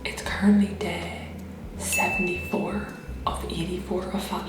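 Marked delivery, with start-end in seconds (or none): 0.0-2.9 whispering. Real, not acted. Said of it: "I'm having to whisper this morning".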